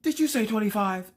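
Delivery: very high, strained question; hammed-up breathless disbelief; mock asthmatic shock. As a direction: squeak it out as if gasping for air. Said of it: "had an asthma attack".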